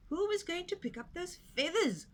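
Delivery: high grumbling goat; complaining goat. A narrator's reading. Old goat complaining about the feathers, high. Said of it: "complained the old goat".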